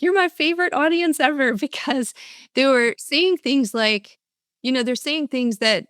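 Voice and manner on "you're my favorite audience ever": high, bright burst of delight; delighted exclaim. Retold or imitated. Speaker telling the Romanian crowd they're the best, excited. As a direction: gush to the crowd with joy. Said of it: "I, I was like".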